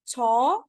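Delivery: छौ alone as one held syllable; isolated छौ for learners to copy; syllable demo. Shown as a demonstration. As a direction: sound out छौ slowly by itself. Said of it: "this will be".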